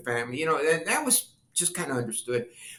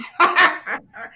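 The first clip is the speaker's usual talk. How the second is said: real laugh; 12 semitones higher, 7 dB louder than usual. Real, not acted. Speaker laughing at own story.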